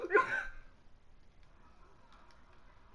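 breathy high cackle after the scream; real laughter. Real, not acted. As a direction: let the laugh spill out high and airy.

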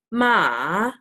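ma dipping down then rising like a V; Mandarin third tone. Shown as a demonstration. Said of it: "The third one is a dip".

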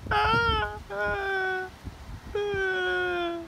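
high, soft fake crying; mock crying. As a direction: whimper in mock sorrow. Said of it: "that's why I was saying so sad".

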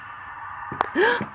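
high breathy gasp; startled gasp. Real, not acted. Vlogger startled by strange lights, high gasp. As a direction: suck in air in alarm.

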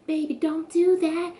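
high-pitched pleading girlfriend imitation; mock girlish plea; Kiara. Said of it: "And then Kiara's like".

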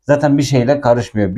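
normal talking voice

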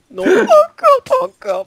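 very high squealed protest, fading in volume; wailing protest. Real, not acted. Player protesting yet another setback, high wail. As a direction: squeal it out, loud at first.

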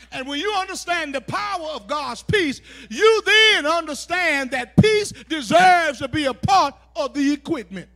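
loud, driving sermon delivery; forceful preaching. Acted. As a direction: preach the list out with rising force.

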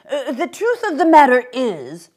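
a hint of a courteous old gentleman pleading; polite old man. Old man's pleading speech.

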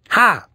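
sharp, scornful "ha"; scoffing ha. A narrator's reading. Boy scoffing at mom's promise, sharp ha.

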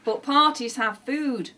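usual speaking voice

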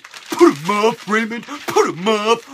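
loud, drawn-out put-on challenge; wrestler impression. Taunting a fan on request, loud wrestler impression. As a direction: challenge him like a cartoon wrestler. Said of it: "say my name like Super Cena, Kane, and Roman Reigns".

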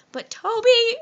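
loud, high, slow begging; hammed-up plea. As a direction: beg him dramatically to marry you. Begging proposal to crush.

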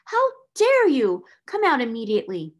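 outraged cry. A narrator's reading. Headmistress ordering the hidden maid out, furious. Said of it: "she cried out".

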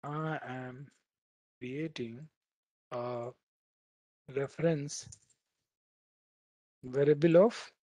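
word by word, as if typing it out; dictating slowly. Shown as a demonstration. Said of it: "Let me write it".

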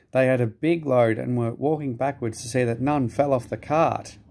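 ordinary delivery

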